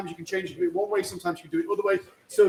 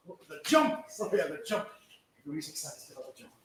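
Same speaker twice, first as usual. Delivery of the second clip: usual speaking voice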